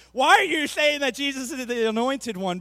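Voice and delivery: loud, indignant-style challenge; mock protest. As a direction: call it out loudly as a hammed-up objection. Acted protest at movie swearing.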